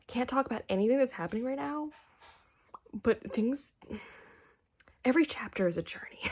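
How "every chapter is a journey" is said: quick excited whisper. Real, not acted. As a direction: whisper it in awe. Hushed awe at the book.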